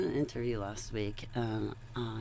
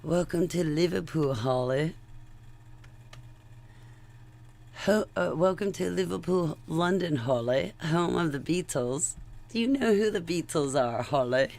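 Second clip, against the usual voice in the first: put-on a rough try at a British accent, brighter and louder; imitating the British interviewer's accent, higher; attempted British accent; 6 semitones higher, 5 dB louder than usual. A vlogger mimics the British host greeting her, in a loose British accent. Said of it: "I'm gonna get the accent wrong".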